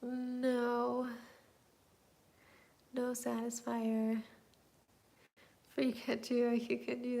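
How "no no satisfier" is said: normal talking voice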